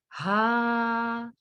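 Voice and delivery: हा held long on one note; stretched haa. Shown as a demonstration. Teacher showing the verse ending, हा held long. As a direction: draw हा out slowly. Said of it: "खींच रहे हैं हम".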